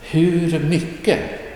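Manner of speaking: Hur mycket said slowly and high; slow, careful, high Hur mycket; lifted teacher demo of Hur mycket. Shown as a demonstration. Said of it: "teacher demonstrates pronunciation".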